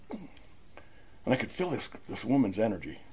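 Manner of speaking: slow, breathless near-whisper; whispering, overcome by the memory; hushed whisper. Real, not acted. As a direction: drop to a moved whisper. Hushed, moved recollection.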